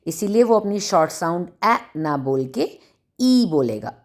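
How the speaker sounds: normal talking voice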